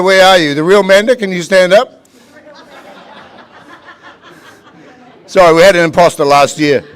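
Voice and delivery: loud, rising auction call to the room; auctioneer calling bids. Acted. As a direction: drive the bidding like a live auctioneer.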